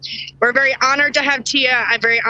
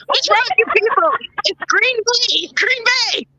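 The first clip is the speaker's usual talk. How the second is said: higher, indignant teasing cry; mock outrage; 8 semitones higher than usual. Host scolding cohosts over football teams, in hammed-up mock outrage. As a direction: playfully scold the team's fans.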